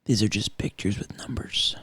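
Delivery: whispered, hushed. Acted. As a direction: breathe it as a secret. Brooding detective musing.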